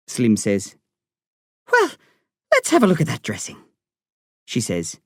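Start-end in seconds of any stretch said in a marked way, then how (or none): 1.7-3.6 put-on quick, high Irish accent; a hint of a brisk Irish doctor, pitched up. A narrator's reading. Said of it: "I love her Irish accent".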